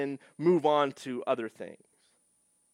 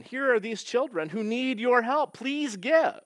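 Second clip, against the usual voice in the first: lightly voiced charity commercial, higher; charity ad appeal; 9 semitones higher, 4 dB louder than usual. TV commercial asking viewers to give, mock appeal. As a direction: plead like a TV fundraising ad. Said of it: "in order to elicit your compassion".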